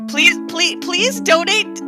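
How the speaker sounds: ordinary talk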